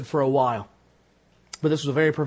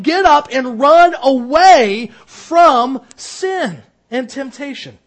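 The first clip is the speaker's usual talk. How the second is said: high, loud, urgent sermon voice; fiery preacher pleading with the flock; preaching at full force; 7 semitones higher, 9 dB louder than usual. Acted. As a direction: press the warning hard on the congregation. Preacher drives home the lesson.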